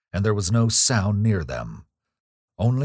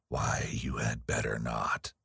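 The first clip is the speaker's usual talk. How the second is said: whispered, leaning on you; emphatic whisper; 7 semitones lower, 8 dB quieter than usual. A narrator's reading. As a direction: hiss the warning under your breath, pressing you. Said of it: "he repeated very emphatically".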